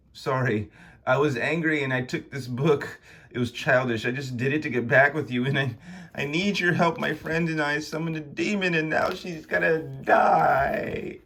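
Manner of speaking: apologetic confession, rising to desperation; pleading Konstantin. Acted.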